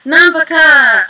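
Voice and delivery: loud, high, delighted cry of the title; light up at the name; excited exclamation. Real, not acted. Guest greets the question about her show, thrilled.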